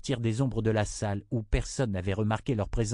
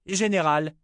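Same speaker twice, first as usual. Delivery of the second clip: higher-pitched summons to a subordinate; calling out; 8 semitones higher, 4 dB louder than usual. A narrator's reading. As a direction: call the general over. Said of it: "appela Callan". (appel lancé d'une voix haute)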